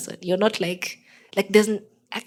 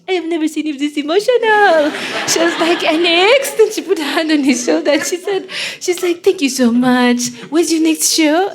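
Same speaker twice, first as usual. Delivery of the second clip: high, loud, emotional imitation; imitating the French wife; 9 semitones higher, 10 dB louder than usual. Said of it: "she's French".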